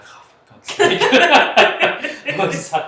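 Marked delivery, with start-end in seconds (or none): 0.0-1.0 loud, high mock exasperation; exasperated dad. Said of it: "my dad was just like". 1.1-2.3 big high burst of laughter; loud laugh. Real, not acted. Laughing after dad quote.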